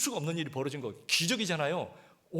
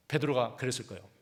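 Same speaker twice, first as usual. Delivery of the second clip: ordinary delivery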